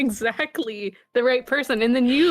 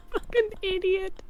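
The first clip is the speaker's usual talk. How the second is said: laughing insult; 9 semitones higher, 5 dB quieter than usual. Real, not acted.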